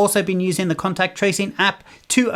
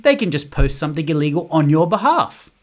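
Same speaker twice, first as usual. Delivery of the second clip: ordinary talk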